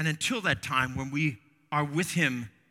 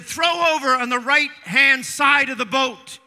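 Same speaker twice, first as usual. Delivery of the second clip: raised, carrying shout of instruction; retold yell; 8 semitones higher, 9 dB louder than usual. Jesus telling the fishermen where to cast, yelling. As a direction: shout directions out to a distant boat. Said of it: "he yells out".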